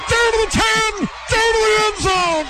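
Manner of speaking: rising excited play-by-play shout; building to a big touchdown shout; touchdown call. Real, not acted. Radio play-by-play long run.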